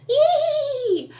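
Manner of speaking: high, loud giddy "yee" with a laugh; high laughing cowboy whoop; playful cowboy yelp. Retold or imitated. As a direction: whoop it like riding a bucking pony. Husband playing pony with son.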